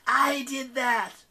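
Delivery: high, slow boast; boasting demon. Acted. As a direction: stress the I proudly.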